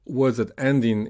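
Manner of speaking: usual speaking voice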